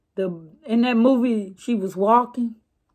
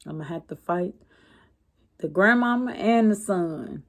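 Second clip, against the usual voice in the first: soft, low muttered thought to herself; inner worried thought; 4 semitones lower, 5 dB quieter, faster than usual. Retold or imitated. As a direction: say it under your breath like a private worry. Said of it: "This what was going through my head".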